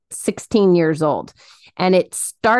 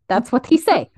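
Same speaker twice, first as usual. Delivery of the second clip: higher put-on Russian accent; 5 semitones higher than usual. Cohost capping the cartoon bat quote, Russian accent. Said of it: "Russian accent".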